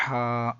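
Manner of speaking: ہا with a strong rasped throat h; mid-throat H. Shown as a demonstration. Teacher points out the needed sound, throaty ہا. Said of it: "یہ جو آواز آپ سن رہے ہیں".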